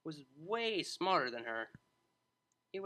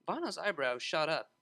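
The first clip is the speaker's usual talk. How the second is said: ordinary delivery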